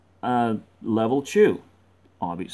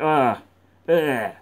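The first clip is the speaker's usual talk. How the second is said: mock vomiting; 2 semitones higher, 3 dB louder than usual. Drunk guys puking on each other, mock retching. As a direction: pretend retching noises. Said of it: "they just vomit on each other".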